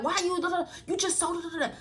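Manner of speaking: a light mock of a questioning mother; mimicking mom.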